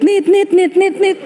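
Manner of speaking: high-pitched imitated phone beeps; busy tone. Preacher mimicking the phone line going dead, high beeping. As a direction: mimic the dial tone after a hang-up.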